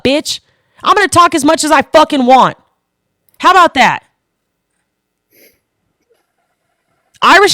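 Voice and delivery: high, heated shouting back at a heckler; defiant, impassioned rant; real angry yelling. Real, not acted. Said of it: "Would you like me to be fucking louder for you?".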